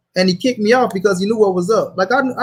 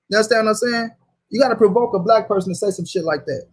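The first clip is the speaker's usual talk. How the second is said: ordinary talk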